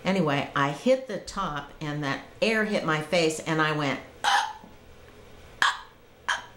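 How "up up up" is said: choked gasps on each up; gasping for air. Retold or imitated. Surfacing from the river, mock choking gasps. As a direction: reenact failing to breathe in. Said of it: "like that".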